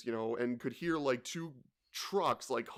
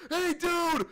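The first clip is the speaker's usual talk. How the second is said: much higher, loud shouted call; mock angry yell; 7 dB louder than usual. Angry driver yelling at the other truck, mock shout. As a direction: bark it out like an enraged driver.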